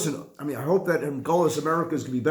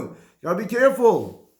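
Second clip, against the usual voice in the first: ordinary talk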